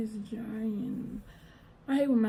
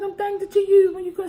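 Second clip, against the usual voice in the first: put-on high child voice; 11 semitones higher, 5 dB louder, faster than usual. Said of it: "I said".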